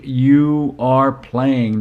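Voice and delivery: "You are playing" slowly, each word weighted; firm, drawn-out stress on "You are playing"; emphatic insistence. Dad overrules daughter with "You are playing", slow and emphatic.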